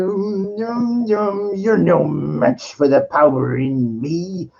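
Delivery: slow, deliberate Emperor line over hummed score; slow villain taunt. Retold or imitated. Retelling the climax, slow mocking Emperor taunt. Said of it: "he goes".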